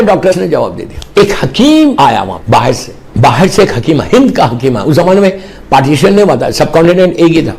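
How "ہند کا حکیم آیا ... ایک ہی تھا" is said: ordinary talk, nothing marked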